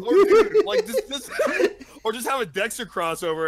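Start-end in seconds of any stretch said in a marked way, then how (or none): 0.0-1.3 much higher, louder laughing burst; excited laughing. Real, not acted. Pitching a crossover joke, much higher excited laughing. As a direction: squeal the idea out.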